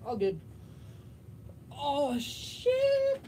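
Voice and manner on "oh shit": startled. Real, not acted. Sudden startled outburst.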